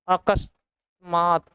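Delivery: अकस्मात very slowly in two parts, a-kas, maat; slow, measured अकस्मात with a long final maat; drawn-out correct form. Shown as a demonstration. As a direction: stretch अकस्मात out for dictation. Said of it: "यही सही होगा".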